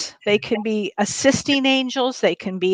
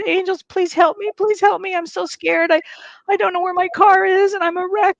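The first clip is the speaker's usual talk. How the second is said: pleading in tears; 8 semitones higher than usual. Retold or imitated. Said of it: "I literally started crying for my angels".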